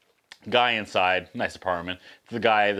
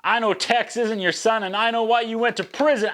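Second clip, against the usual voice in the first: high-pitched mock yell; imitating an angry son confronting his dad; retold yell; 9 semitones higher than usual. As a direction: hurl it as a hammed-up accusation. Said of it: "Mason yells at their dad".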